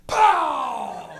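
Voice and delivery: loud, drawn-out "pow"; blast noise. Acted. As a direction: fire off a cartoon energy blast. Imagined energy blast.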